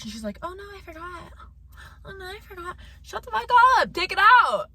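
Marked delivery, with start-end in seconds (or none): 0.4-2.8 soft, high-pitched mimicry of a coworker; mocking coworker. Mimics careless coworker's excuse. 3.0-4.7 loud, high-pitched exasperated order; angry outburst. Retold or imitated. Furious retort to coworker's excuse.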